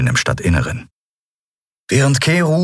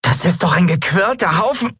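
hohe, fremde Stimme, aufgebracht; hoch und frech losschimpfen; Nelen, empört; 12 semitones higher than usual. A narrator's reading. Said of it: "setzte Nelen an". (Nelen schimpft über de Larco, hoch)

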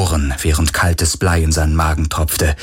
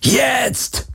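very high, strained yell held back in volume; desperate high-pitched bellow; roared command. A narrator's reading. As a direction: cry out the signal to run. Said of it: "brüllte Garlin".

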